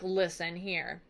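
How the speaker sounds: low, soft, slow dad voice; firm father. Retold or imitated. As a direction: settle the son down slowly and firmly. Odysseus firmly answers his son.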